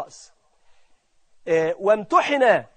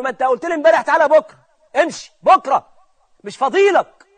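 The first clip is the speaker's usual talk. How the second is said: loud, raised, irritated dismissal; brusque brush-off; 5 semitones higher, 8 dB louder than usual. Acted. Office clerk fobbing off a returning client, brusque and loud. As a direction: send them away impatiently, voice up.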